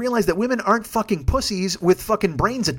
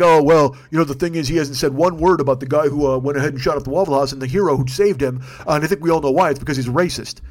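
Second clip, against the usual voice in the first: mocking know-it-all; 6 semitones lower than usual. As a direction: sneer it like a smug online commenter. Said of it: "They're like".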